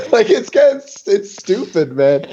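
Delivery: high, giggly incredulity; laughing disbelief. Real, not acted. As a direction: laugh helplessly through the words. Laughing at own run of wins.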